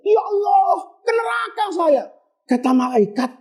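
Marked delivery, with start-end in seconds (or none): 0.1-2.0 higher, pleading wail of a man doomed; a touch of tearful panic, mildly acted; mock crying. Ahmad bin Miskin fearing hell, half-hearted tearful cry. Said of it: "Nangis dia".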